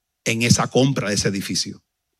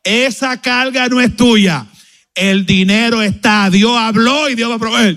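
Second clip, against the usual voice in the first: slow, higher, louder prophetic voice; prophetic declaration; 9 semitones higher, 7 dB louder, slower than usual. Retold or imitated. Pastora prophesying over the pastor, slow, high and loud. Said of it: "comenzó a profetizar".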